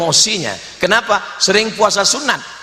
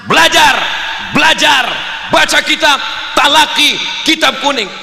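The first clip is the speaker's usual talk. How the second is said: rousing shout; 6 semitones higher than usual. Shown as a demonstration. Preacher rousing students to study, spirited shout. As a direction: fire them up, word by word. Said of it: "bangkitkan semangatnya".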